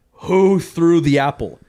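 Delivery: loud, stern demand; terrifying teacher. Retold or imitated. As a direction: confront the kids as an angry teacher. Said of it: "Like, it was terrifying".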